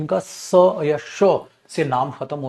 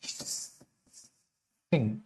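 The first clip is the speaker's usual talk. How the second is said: Hissing stretched very slowly and soft; slow soft hissing sound; drawn out; 5 semitones lower, 11 dB quieter, much slower than usual. Shown as a demonstration. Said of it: "hissing sound आ गई".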